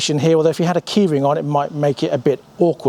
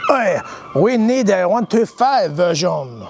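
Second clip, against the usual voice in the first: slow, mock-French accent; 2 semitones higher, slower than usual. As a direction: put on your best French accent. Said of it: "in their best French accent".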